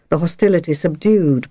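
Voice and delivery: ordinary delivery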